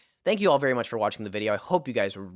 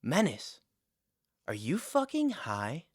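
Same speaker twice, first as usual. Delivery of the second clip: higher, softer, drawn-out question; mock airhead voice, quieter and pitched up; dim-witted classmate; 3 semitones higher, 5 dB quieter than usual. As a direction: imitate a clueless classmate asking slowly. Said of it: "with her two brain cells and just goes".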